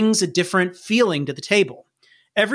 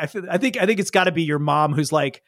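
ordinary delivery

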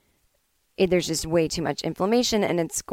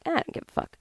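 not caring; 10 semitones higher, 6 dB quieter, faster than usual. Retold or imitated. Dismissive quote of herself.

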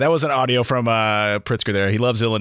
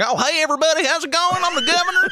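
very high, hammed-up folksy politician; mock Midwest governor. Midwest governor greeting a UK crowd, high hammed-up folksy voice. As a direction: introduce yourself as a cartoonish Midwesterner, pitched way up. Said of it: "they were expecting, like".

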